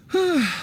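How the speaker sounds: long wistful sigh; heavy sigh. Acted. Shopkeeper lamenting his lord's passing, sighing. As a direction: let a sad breath out. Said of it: "getting all sentimental like this".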